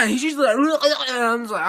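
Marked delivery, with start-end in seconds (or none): 0.6-1.0 high, loud comic yell; hammed-up attacking shriek; mock fight cry. Girl brawling with the jerk, mock fight cry.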